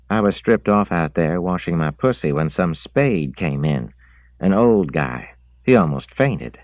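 a hint of a bawdy young woman. Woman voiced by narrator.

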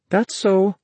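put-on high girl character in narration. A narrator's reading. Girl's line in narrated dialogue.

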